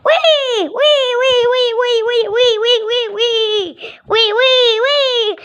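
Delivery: high excited wees with laughter; gleeful wee. Pretend. Child voicing birds sliding down, high laughing wees. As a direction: squeal with glee as if sliding.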